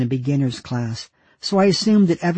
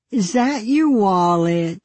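put-on higher, slow sing-song child question; 6 semitones higher, slower than usual. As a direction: tease it in a sing-song lilt. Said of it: "asked in a sing-song voice".

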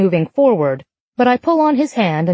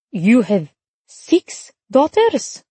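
put-on Italian accent; 4 semitones higher, much slower than usual. A narrator's reading. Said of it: "In a thick Italian accent".

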